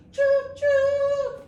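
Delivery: high, loud choo choo whistle; train whistle. Shown as a demonstration. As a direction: toot it like a steam train. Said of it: "like a train".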